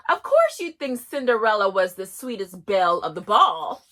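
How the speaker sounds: ordinary talk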